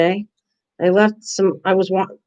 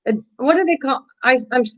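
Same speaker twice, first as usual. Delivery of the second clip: normal talking voice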